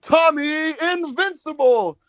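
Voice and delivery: high, slow, stretched-out announcement; mock ring announcer. Announcer introduces the surprise opponent, high drawn-out mock call. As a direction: draw the name out like a big reveal.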